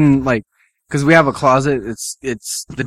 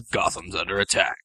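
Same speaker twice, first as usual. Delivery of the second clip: low, softer gruff warning; understated Batman rasp; 6 semitones lower, 9 dB quieter than usual. Retold or imitated. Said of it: "He talks like this".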